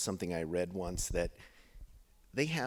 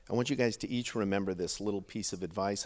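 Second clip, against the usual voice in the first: ordinary talk, nothing marked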